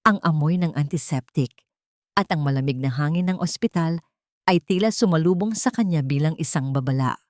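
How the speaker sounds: usual speaking voice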